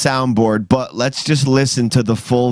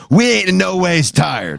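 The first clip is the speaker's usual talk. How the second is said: imitated gospel line; 6 semitones higher than usual. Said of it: "YouTube wants Stuck to be".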